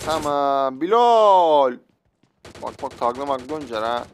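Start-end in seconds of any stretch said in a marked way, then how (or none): 0.8-1.8 calling out. Real, not acted. Calling out a teammate's name.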